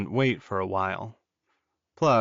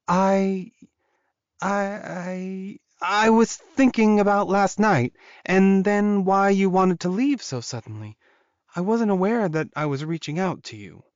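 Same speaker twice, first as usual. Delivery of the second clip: put-on higher, stammering young woman voice; flustered young woman; 9 semitones higher, 4 dB louder than usual. A narrator's reading. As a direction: stumble over the words, embarrassed.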